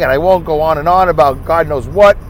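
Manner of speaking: usual speaking voice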